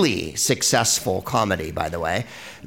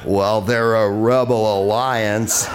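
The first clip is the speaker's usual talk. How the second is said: John Wayne impression; slower than usual. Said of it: "if John Wayne had lived".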